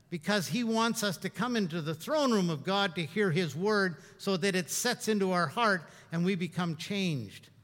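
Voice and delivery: normal talking voice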